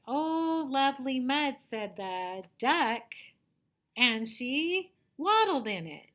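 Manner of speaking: high, loud, expressive storybook reading; reading like a teacher. Shown as a demonstration. Teacher reading about the duck, loud expressive read-aloud. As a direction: draw out the animal's exclamation, then narrate it big. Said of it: "if you're gonna read it like a teacher".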